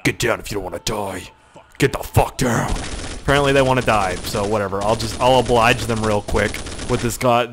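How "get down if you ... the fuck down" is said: gruff action hero. Shown as a demonstration. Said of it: "watch this".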